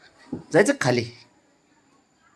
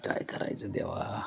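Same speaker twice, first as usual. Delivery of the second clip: whisper; 2 semitones lower, 16 dB quieter than usual. Real, not acted. Exasperated aside.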